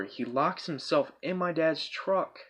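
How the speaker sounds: ordinary talk, nothing marked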